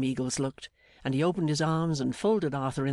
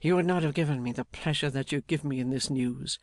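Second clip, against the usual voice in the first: ordinary delivery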